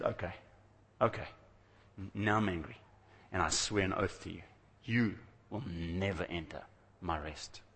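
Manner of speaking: low, quiet, measured retelling of God's anger; restrained anger. Retold or imitated. Preacher voicing God's oath against Israel, quietly angry. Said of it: "God swore an oath in anger".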